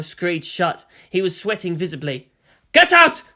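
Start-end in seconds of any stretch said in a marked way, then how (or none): 2.7-3.2 screamed, very high and loud; panicked driver yelling get out; furious shout. Acted. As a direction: throw her out of the cab at full volume.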